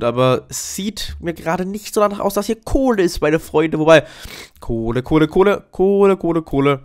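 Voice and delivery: ordinary delivery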